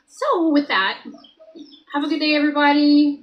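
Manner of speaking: loud, fast sign-off. Real, not acted. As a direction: call it out quickly and loudly. Loud sign-off to stream viewers.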